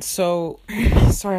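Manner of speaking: breathless after hot yoga; out of breath. Real, not acted. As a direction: speak winded and puffing. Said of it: "I sound like I'm out of breath".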